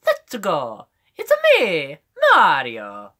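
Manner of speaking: loud, high, bouncy cartoon voice; Mario. Shown as a demonstration. Streamer doing impressions, loud high Mario voice. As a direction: leap up high, then swoop down on the name. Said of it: "can also do kind of a Mario".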